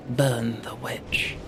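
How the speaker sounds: slow whispered mob chant. Pretend. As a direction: hiss it low and slow.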